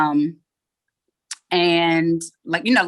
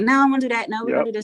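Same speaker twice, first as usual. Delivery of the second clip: controlling; 5 semitones higher, 4 dB louder, much faster than usual. Retold or imitated. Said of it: "I was, like, really controlling about everything".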